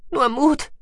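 high, loud creature voice; squeaky mouse-beaver voice. Acted. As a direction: pipe it out eagerly. Gucki voiced.

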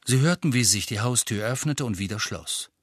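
ordinary delivery, nothing marked

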